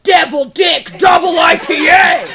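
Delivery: loud hammed-up macho shout; mock aggressive beer names. Comic mocking craft beer names, hammed-up shout. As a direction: bellow the beer names like a monster truck ad. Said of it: "You guys notice that shit".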